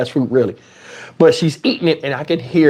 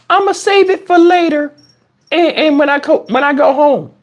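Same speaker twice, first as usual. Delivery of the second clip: higher, lightly voiced kid; little girl voice; 9 semitones higher than usual. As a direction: play the daughter making excuses. Daughter voiced excusing herself.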